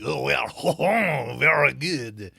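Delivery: hammed-up French with a chuckle; cartoonish French accent. Shown as a demonstration. Showing how his French always ends up cartoonish, silly French accent. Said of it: "always ends up being cartoonish".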